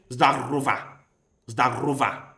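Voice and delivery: ZDAROVA with a trilled Spanish-style R; hard rolled R. Shown as a demonstration. Learner applying Spanish R to Russian hello, hard R. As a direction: roll the R firmly in Zdarova. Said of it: "The R should be hard like in Spanish".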